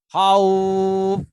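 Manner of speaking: khauf with the vowel drawn out; long khauf. Shown as a demonstration. Teacher showing students an allowed long khauf. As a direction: hold the au in khauf. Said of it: "kamu boleh membaca dengan cara".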